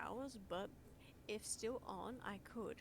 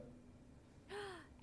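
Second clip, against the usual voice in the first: excited gasp; 10 semitones higher, 7 dB quieter than usual. Real, not acted. Streamer spots a viewer arriving.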